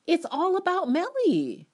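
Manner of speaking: very high, loud sarcastic jab; mock her self-centredness; mocking whine. Real, not acted. Mocking complaint.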